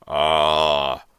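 loud, long, disgusted ah; disgusted groan. A narrator's reading. Father inhaling the foul smell, disgusted. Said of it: "His face twisted in disgust".

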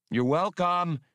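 loud, high, sarcastic call after him; called out sarcastically. Acted. Hasty calls after Han as he leaves, loud and sarcastic. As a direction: fling it at his back, mock polite. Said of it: "she called after him".

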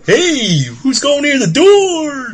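mock [name] voice. Friend riffing on [name] lyrics in a high, slow voice. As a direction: drag it out in a silly voice.

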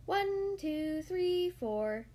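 drawn-out count, stepping down in pitch; sing-song counting, starting high; teetering count. A narrator's reading. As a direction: count each careful step from stone to stone. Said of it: "he counted as he teetered".

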